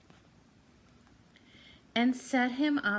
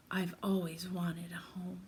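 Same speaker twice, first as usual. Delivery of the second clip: soft unvoiced whisper; 4 semitones lower, 8 dB quieter than usual. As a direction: breathe the wish quietly. Bear's wish, spoken softly.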